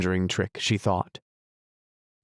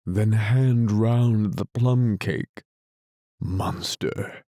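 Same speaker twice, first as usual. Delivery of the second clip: weary lion; much slower than usual. A narrator's reading. Weary lion giving orders.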